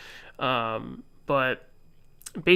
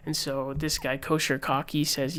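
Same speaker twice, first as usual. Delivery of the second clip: ordinary delivery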